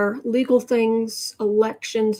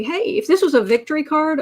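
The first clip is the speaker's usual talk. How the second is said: higher, louder cheerful exclamation; excited; 5 semitones higher, 4 dB louder than usual. Retold or imitated. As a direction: celebrate a win. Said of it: "I'd say".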